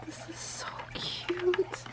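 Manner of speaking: hushed, tearful delight; whisper. Real, not acted. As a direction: breathe it out in awe. Touched by the gift.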